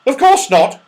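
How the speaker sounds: very high, loud amused squirrel; laughing squirrel. Acted. Squirrel amused by Franny's question, very high and loud. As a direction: laugh off the idea. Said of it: "he said".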